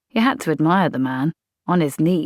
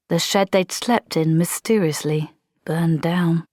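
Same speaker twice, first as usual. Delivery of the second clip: plain reading voice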